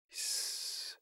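very soft, voiceless shush; barely audible hushing; quiet hush. A narrator's reading. Mother quietly cuts off Pa's talk, hushing. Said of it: "مادر آهسته گفت".

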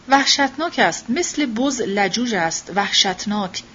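usual speaking voice

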